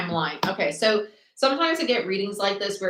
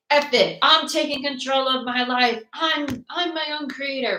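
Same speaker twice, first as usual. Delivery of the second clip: higher-pitched fed-up declaration; defiant resolve; 6 semitones higher than usual. Acted. Tarot reader voices someone fed up, defiant. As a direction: declare it like you're taking charge.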